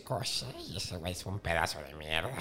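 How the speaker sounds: put-on low old witch voice; old Baba Yaga voice. Baba Yaga inviting the hero inside, low old crone voice.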